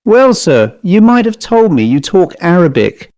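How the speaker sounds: mildly hurt Cockney servant; wistfully reproachful. A narrator's reading. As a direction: gently reproach someone who let you down. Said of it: "tone was now wistfully reproachful".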